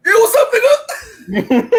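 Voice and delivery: casual greeting voice. Retold or imitated. Alan greets the Thundercats leader casually, high and loud. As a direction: toss it off high and loud, buddy style. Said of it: "looks to the side".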